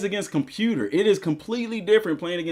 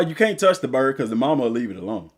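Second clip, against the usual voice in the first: usual speaking voice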